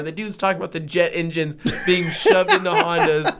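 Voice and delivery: breaking into laughter; can't hold the laugh. Real, not acted. Recalling the jet engine guy to cohosts, laughing.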